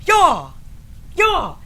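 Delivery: high loud repeated yaw; karate shouts. Retold or imitated. Students breaking boards in line, repeated karate yells. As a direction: bark out yaw twice. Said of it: "everybody's doing the same".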